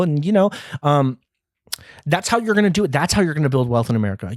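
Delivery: usual speaking voice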